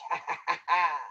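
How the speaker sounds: low wolf laugh; villainous chuckle. Acted. Wolf gloats over lunch, low chuckle.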